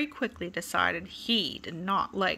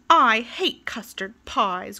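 louder, slow grumpy complaint; grumbling; 2 semitones higher, 7 dB louder, slower than usual. Acted. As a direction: drag it out like a sulky grump. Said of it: "grumbled Mister Grumble".

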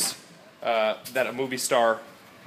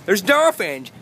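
excited; 6 semitones higher, 6 dB louder, slower than usual. Real, not acted. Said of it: "Look at the dolphins".

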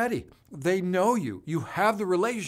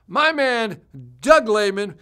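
much higher and louder shout-out; excited shout-out; 7 semitones higher, 11 dB louder, slower than usual. Real, not acted. Host greeting viewer Doug in chat, loud excited shout-out.

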